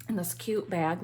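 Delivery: ordinary delivery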